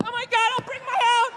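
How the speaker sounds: put-on high, prissy girlish whine; hammed-up fussy girlfriend; mock prissy girl. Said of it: "sitting there going".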